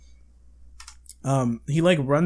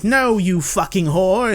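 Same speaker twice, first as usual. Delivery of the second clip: slow, louder, hammed-up snarl; mock rude reply; 5 semitones higher, 6 dB louder, slower than usual. Hero turning on the girl who helped, mock angry. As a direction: spit it out in mock contempt.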